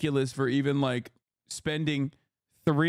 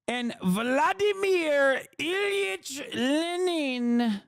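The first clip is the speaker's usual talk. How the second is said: much higher, very slow, drawn-out proclamation; mock grandiose intoning; 12 semitones higher, much slower than usual. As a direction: intone each name like a grand anthem. Mock grand reverent Lenin intoning.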